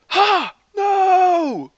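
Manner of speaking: loud, very high shouted reactions; triumph, then protest. A narrator's reading. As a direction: yell a gloating cry, then an outraged refusal. Tony and Thor yelling back.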